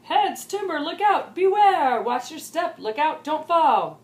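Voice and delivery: loud, high shouted warnings; girl screaming warnings for no reason, loud and high. Acted. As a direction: yell them out as loud as you can. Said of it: "she would scream".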